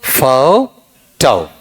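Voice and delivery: फोटो very slow, "fau... tau"; फोटो syllable by syllable with a pause between; broken into syllables. Shown as a demonstration. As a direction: split फोटो into two long sounds. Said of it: "बताओ क्या बनेगा".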